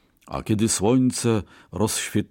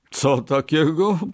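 high-pitched, incredulous cry; exclaiming merchant; 8 semitones higher, 4 dB louder than usual. A narrator's reading. Merchant reacts to a new bet, exclaiming. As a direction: exclaim it high as a startled question. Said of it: "wykrzyknął kupiec".

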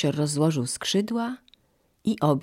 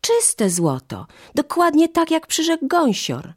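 put-on high, louder children's insistence; eager confirmation; 12 semitones higher, 4 dB louder than usual. A narrator's reading. Children tell mother of the goose's promise, high and excited. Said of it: "potwierdziły dzieci".

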